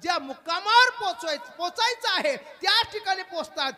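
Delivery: loud, high kirtan preaching; full-force sermon voice; fiery preaching. Acted. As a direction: drive the point home to the crowd.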